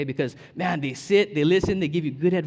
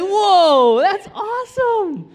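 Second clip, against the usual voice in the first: very high, loud, slow amazement; mock amazed husband; 12 dB louder, much slower than usual. Husband replays his amazed answer to wife. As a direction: stretch it out in wonder. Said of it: "And I'm like".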